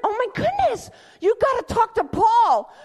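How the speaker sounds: high, loud eager outburst; excited shout. Retold or imitated. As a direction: burst out with sudden excitement. Excitedly telling pastor.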